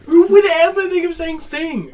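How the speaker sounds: loud, high, drawn-out disbelief; incredulous outburst. Real, not acted. Friend reacts to a child being called a thing, incredulous outburst. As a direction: cry out slowly in amused disbelief.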